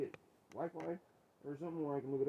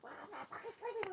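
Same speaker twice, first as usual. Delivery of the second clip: very high, squeaky imitation of indignation; mocking high-voiced protest; mock pissed-off retort. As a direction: mimic an offended comeback far up in pitch. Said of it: "you get so pissed off".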